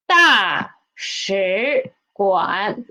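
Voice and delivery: "大使馆" drawn out very slowly; 慢速示范读音; slow model. Shown as a demonstration. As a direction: stretch "大使馆" for learners. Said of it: "we will put 使 as the half the tone".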